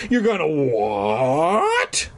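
hammed-up disbelief stretched on "what"; cried out. A narrator's reading. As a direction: drag out "what" into a rising wail. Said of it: "Fergie cried".

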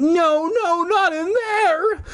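high, pleading cry of fear; frightened scream. A narrator's reading. Fake Fergie screaming as dogs drag her, high frightened cry. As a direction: beg not to be pushed in. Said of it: "screamed with all her might".

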